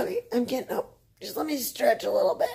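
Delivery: high-pitched reply to an impatient pet; playful pet talk. Real, not acted. Talking to pet while getting up.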